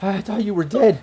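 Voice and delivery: high, emotional disbelief; acted overwhelmed female voice; crying. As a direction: say it tearfully as a shocked ex-girlfriend. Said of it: "she's just crying".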